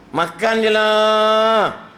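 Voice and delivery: slow, loud, high drawn-out line; mock drawn-out call. Preacher mocking: just eat it. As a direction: stretch it out teasingly.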